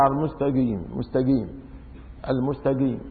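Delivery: المستقيم with a hard g, "al-mustageem"; Bedouin-style g in المستقيم; qaf between q and k. Shown as a demonstration. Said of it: "بالقاف مترددة، ها، بينها وبين الكافية".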